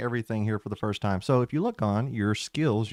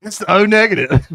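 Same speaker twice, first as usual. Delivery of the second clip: loud, high-pitched comic voice; big raised boozy vampire; tipsy vampire excuse; 10 semitones higher, 12 dB louder than usual. Acted. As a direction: blurt the excuse in a hammed-up drunk voice. Jokes in Melvin's drunk voice.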